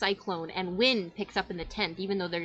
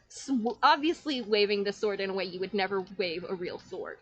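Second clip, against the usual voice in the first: normal talking voice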